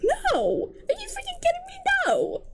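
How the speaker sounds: high, squeaked protest; outraged disbelief. Real, not acted. Reader protesting at a character's love confession, high-pitched disbelief. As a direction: voice shoots up, appalled.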